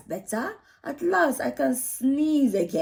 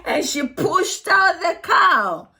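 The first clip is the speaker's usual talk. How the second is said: straining push; 8 semitones higher, 6 dB louder than usual. Shown as a demonstration. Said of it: "a great deal of force".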